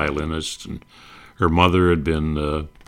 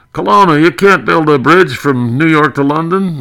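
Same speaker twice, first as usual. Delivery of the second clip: high, loud exasperated Hope; Hope scolding Colonna, high and loud; Bob Hope voice; 10 semitones higher, 7 dB louder than usual. Retold or imitated. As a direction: shout it up high in disbelief. Hope's reply in gag.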